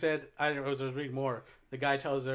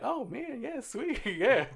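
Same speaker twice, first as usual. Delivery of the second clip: hamster voice; 9 semitones higher, slower than usual. Retold or imitated. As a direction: play the hamster, pitched way up. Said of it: "the hamster's probably like".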